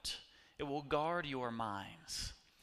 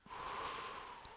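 slow audible exhale through the mouth; long breath out; 8 dB quieter than usual. Shown as a demonstration. Preacher leads the room in breathing out with a long sigh. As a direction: let the air go, slowly. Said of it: "let's all just do that".

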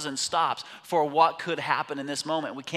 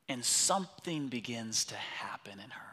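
hushed; 5 semitones lower, 8 dB quieter than usual. Real, not acted. Preacher drops to a hush.

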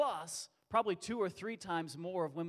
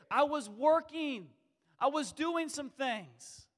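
much higher and louder, slow and pleading; pleading Jesus; 11 semitones higher, 12 dB louder than usual. Retold or imitated. Jesus calling out to Peter who quit, slow and high pleading. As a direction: ask why he quit, longing. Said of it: "I can just hear Jesus saying".

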